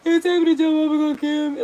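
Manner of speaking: high, wailed re-enactment of sobbing; mock crying. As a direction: wail it like a child in big trouble. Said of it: "it really be like that".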